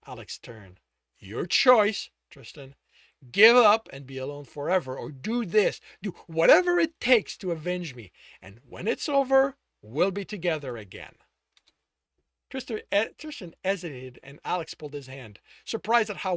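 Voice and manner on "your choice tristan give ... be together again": hard ultimatum. Acted. Alex issuing ultimatum.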